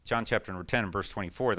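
ordinary talk

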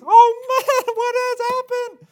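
very high, loud mock panic with a laugh; squealing mock fright, very high and loud; panicked Philistines. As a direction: ham up the scared enemy. Preacher voices frightened Philistines.

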